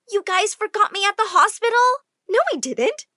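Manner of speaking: indignant. Acted. Daughter confronting parents who forgot her, indignant. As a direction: protest in disbelief.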